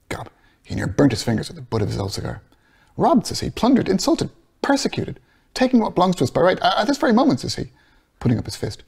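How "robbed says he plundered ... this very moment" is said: higher, building passionate protest; impassioned Bloom. Acted. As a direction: plead louder and higher as it builds. Bloom's indignant list.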